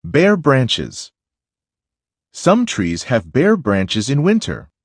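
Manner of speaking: normal talking voice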